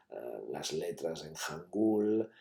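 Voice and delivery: usual speaking voice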